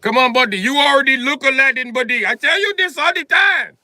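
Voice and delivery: loud, high mock Indian accent ending in a shout. Friend teases him about looking like Aladdin, loud mock Indian accent. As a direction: yell it like the pushy friend. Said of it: "He's like".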